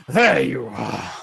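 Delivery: slow, drawn-out booming greeting; big man's hearty call; deep booming voice. Acted. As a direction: boom it from behind the listener. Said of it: "this deep booming voice behind you".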